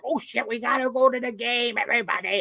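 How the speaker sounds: lightly voiced Adam Sandler impression; a hint of Adam Sandler, quick and casual. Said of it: "Adam Sandler voice".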